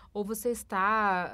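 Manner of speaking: normal talking voice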